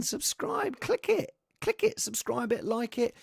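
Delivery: high, eager click it, subscribe it; excited plea. Acted. Host urging viewers to click subscribe, high. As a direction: hype up the subscribe button.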